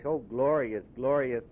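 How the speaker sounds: ordinary delivery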